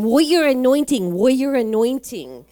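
loud proclaiming. Retold or imitated. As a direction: declare it over him loudly. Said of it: "with a broken English".

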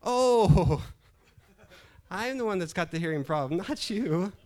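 big loud, high "oh" of discovery; sudden realisation. Acted. Preacher voicing who really can't hear, loud realisation. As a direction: exclaim it as the truth suddenly lands, high and loud. Punchline, husband's fault revealed.

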